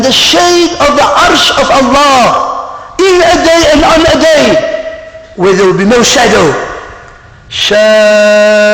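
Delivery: slow, drawn-out sermon delivery with rising force; impassioned preaching. Acted. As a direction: proclaim it slowly, stretching the key words. Preacher proclaims hadith.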